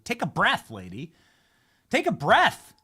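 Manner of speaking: high, loud, fed-up plea to calm down; mock therapist begging her to breathe; exasperated therapist. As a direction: pretend therapist yelling at a ranting patient to breathe. Mock therapist to screaming woman.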